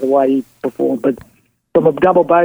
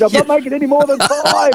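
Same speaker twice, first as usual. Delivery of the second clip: indignant player; 12 semitones higher than usual. Retold or imitated. Player refusing, retold quote.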